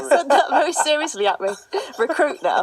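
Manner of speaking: talking through a laugh; giggling while speaking. Real, not acted. Laughing at a serious tip.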